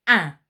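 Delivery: pinched-nose duck-like nasal vowel; loud nasal duck honk with the nose held; nasal duck sound. Shown as a demonstration. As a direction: quack it out through a pinched nose. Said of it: "you're gonna pinch your nose".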